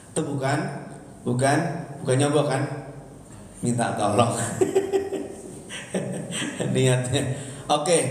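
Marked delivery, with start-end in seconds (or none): 4.4-6.7 quiet laugh; real laughter at a police story; breathy laugh. Real, not acted. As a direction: laugh at the joke. Laughs at own joke.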